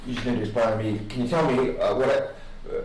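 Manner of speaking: usual speaking voice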